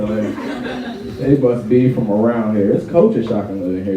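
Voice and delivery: usual speaking voice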